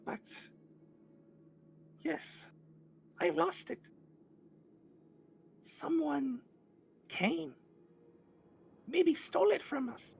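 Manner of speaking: very high, loud squeaky creature voice; a sad little kobold fondly remembering his pet; Meepo describing the dragon. Acted. Meepo describing his lost wormling Calcrix, high mournful voice. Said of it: "and he describes it".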